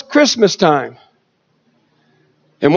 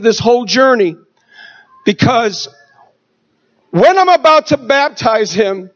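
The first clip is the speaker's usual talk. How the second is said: ordinary delivery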